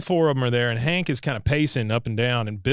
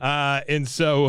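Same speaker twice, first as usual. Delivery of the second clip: ordinary talk, nothing marked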